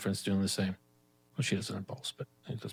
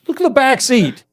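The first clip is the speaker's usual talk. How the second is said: exasperated shout; 15 dB louder than usual. Retold or imitated. Exasperated driver points cop.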